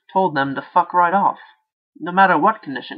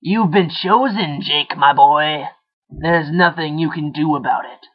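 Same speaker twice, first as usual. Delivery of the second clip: nasty inner voice; 5 dB louder than usual. Acted. The taunting inner voice.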